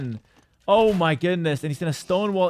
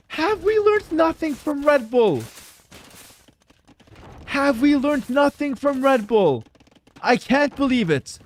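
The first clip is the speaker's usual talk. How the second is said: exasperated; 7 semitones higher, slower than usual. Real, not acted. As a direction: scold the player in disbelief. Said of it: "Now I'm getting heated".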